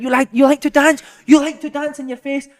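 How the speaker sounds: loud, high mock taunt; hammed-up, high-pitched angry taunting; ranting manager. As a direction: imitate an unhinged boss bellowing at players. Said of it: "And he went".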